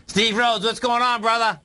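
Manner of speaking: big radio hello to the co-host; hearty on-air greeting. Real, not acted.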